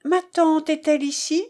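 ingenuous. A narrator's reading. Niece walks in on the banker, asking for her aunt, mildly ingenuous. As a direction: ask innocently, voice lifted a little. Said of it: "articula d'un air ingénu".

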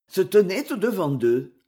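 plain reading voice

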